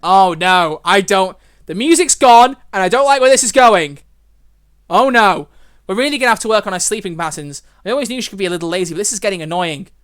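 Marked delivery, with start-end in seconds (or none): 0.0-5.5 loud anxious oh no; worried, rising panic; nervous dread. Real, not acted. Music stops, dread builds.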